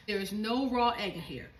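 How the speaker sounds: normal talking voice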